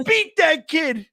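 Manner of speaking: high, loud mock shouts; egging on the beating; mob egging on. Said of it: "It's like".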